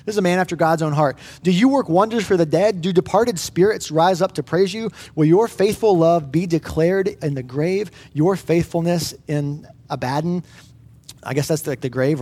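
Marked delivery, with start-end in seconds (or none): 1.4-10.4 slow, solemn reading of a lament psalm; scripture reading. Acted. Pastor reading Psalm 88 to the church, solemn and slow. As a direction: read the psalm like a heavy prayer.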